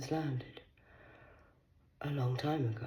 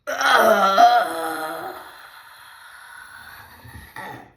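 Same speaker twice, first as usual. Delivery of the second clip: loud wailing cry; 7 semitones higher, 12 dB louder than usual. Acted. Loud wail after mock threat.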